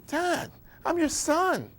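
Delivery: high, slow, pleading "Dad, I'm your son."; a son stunned by his father's threat, pleading high and slow; hurt disbelief. Retold or imitated. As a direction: stretch it out high like a wounded son.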